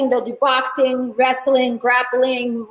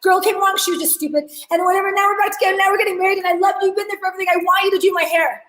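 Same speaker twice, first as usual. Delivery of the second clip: high, gushing thank-you from an overjoyed bride; 6 semitones higher, faster than usual. Retold or imitated. Bride thanking her stylist for always being there, gushing high and fast. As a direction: pour it out like a giddy bride-to-be. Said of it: "She's like".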